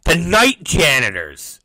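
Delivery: usual speaking voice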